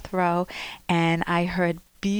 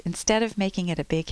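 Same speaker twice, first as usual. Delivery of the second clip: ordinary talk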